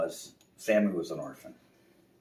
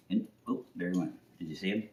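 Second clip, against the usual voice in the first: usual speaking voice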